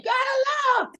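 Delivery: put-on high piercing shout of a little girl; mock high-pitched fan scream; imitated scream. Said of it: "she was screaming so loud".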